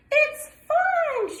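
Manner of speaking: high, loud squeal of fun; duckling in the water calls out, high and loud; delighted cry. Acted. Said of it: "she cried".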